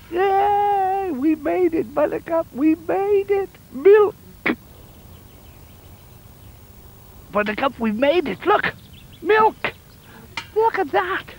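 high, squealing with joy; excited. Acted. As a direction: cheer like you just won. Triumph at getting milk.

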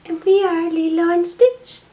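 high, loud sing-song pet voice. Pretend. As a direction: speak for the pets in a squeaky voice. Voicing both pets.

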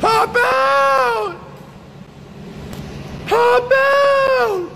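very high, full shout. Real, not acted. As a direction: bellow at him to get out of the truck. Shouting at truck driver.

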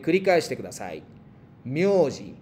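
teacher models myoji, every sound distinct; model pronunciation. Shown as a demonstration. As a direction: say myoji as a clear model for the class. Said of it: "Vamos a practicar las pronunciaciones".

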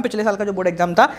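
normal talking voice

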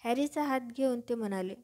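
plain reading voice

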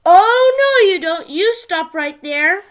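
high loud "Oh, no" then a slow firm stop; loud protest. Acted. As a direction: call out to stop him, loud. Friends stop Spot changing again.

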